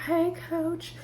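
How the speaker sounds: imitating a high, soft phone voice; switched-up sweet greeting. Mercedes answering the coach's call, voice suddenly switched up. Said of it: "she switched her voice up real quick".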